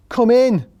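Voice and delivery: a sudden high, loud call to come in; mock shout. Assessor mocked for not shouting come in, hammed-up yell. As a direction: bellow it through the open door, hammed up. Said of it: "propped it open and shouted".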